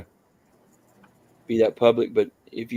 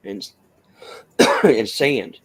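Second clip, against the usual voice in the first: coughing while talking; words broken by a cough; choking; 3 dB louder, slower than usual. Real, not acted. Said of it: "I'm choking on something".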